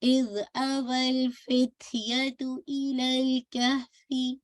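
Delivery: इज अवल फित्यतु इलल कहफी very slow, smooth ल; Quran recitation demo. Shown as a demonstration. Teacher to student, slow smooth recitation. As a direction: recite it slowly without bouncing. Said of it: "bouncing effect नहीं आना चाहिए".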